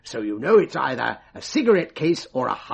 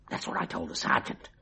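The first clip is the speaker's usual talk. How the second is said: ordinary delivery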